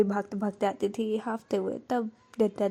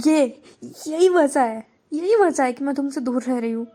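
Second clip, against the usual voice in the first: a louder outburst that breaks out all at once; patience snapping; 3 semitones higher, 6 dB louder than usual. Acted. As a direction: burst out, unable to hold it in any more. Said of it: "अपना सब्र का बांध तोड़ देती है". (सब्र टूटने पर ज़ोर से फूट पड़ना)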